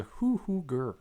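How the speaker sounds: slow hooting and growling; owlbear. Shown as a demonstration. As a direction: hoot twice, then growl like a beast. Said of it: "if you don't know what an owl bear it is".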